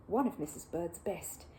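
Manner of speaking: ordinary delivery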